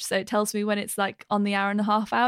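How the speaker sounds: ordinary talk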